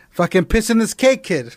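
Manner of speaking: put-on tough Boston accent. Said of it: "We're talking Boston, kid".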